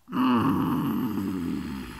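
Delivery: deep grumpy bear hum; low bear grumble hum. Acted. Woken bear grumbling low in his throat. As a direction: rumble it low.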